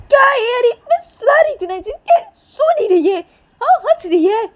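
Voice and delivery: very high, loud protest; indignant near-shout; exasperated protest. Acted. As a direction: defend yourself, at the end of patience. Defending herself in the quarrel.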